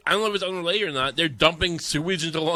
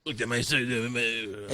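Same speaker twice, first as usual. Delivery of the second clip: mock executive; 8 semitones lower, 9 dB quieter, much slower than usual. Mocking the executive himself.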